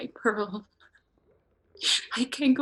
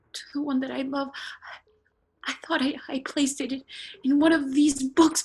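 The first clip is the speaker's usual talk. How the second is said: ordinary delivery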